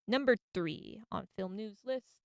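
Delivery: usual speaking voice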